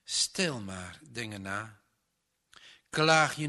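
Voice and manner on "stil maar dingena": low, gentle soothing of a grieving woman; comforting aunt, soft and low. Acted. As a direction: hush her tenderly, much lower. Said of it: "streelde zachtjes over haar wangen".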